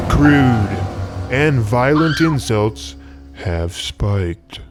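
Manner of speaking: usual speaking voice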